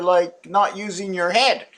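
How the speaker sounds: ordinary delivery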